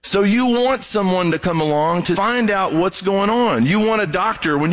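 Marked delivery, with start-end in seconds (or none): none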